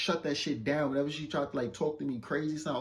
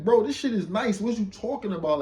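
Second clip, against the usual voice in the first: higher, louder retold pushback; exasperated retort; 8 semitones higher, 5 dB louder than usual. Man arguing with his date about the hotel, exasperated. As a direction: call her out in mock disbelief.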